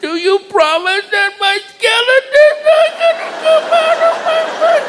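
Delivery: put-on very high, tiny kid voice; very high worried child voice; scared child. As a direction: ask like a frightened little girl.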